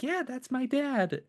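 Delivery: put-on high, plain-spoken little girl; child's voice pitched far up. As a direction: say it like a naive small kid. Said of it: "And she's like".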